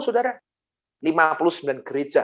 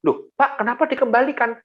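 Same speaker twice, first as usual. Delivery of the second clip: high-pitched, puzzled protest; surprised question; 10 semitones higher than usual. Retold or imitated. Mall manager hears space is being returned, surprised question. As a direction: ask why in astonishment.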